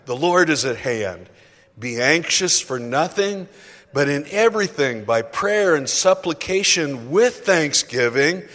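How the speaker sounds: booming unison Bible reading; scripture read aloud. Shown as a demonstration. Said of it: "Would you read this aloud together with me?".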